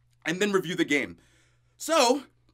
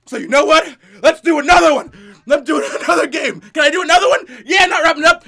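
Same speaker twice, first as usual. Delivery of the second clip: hyping himself up through the pain; excited shouting; 2 semitones higher, 6 dB louder than usual. Real, not acted. Pumped, pushing through the spice.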